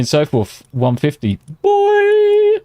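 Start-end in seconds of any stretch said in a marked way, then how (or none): 1.6-2.6 put-on very high, louder yelled "Boy!"; shouted hype cry. Streamer shouting the donor's one-word message, high hype yell. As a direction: belt "Boy!" like a hype call. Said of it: "all he's got is the messages".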